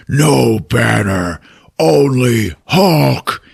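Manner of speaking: slow, heavy-worded brute impression; Hulk voice. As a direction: draw out each word like a simple-minded monster. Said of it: "And then the Hulk speaks".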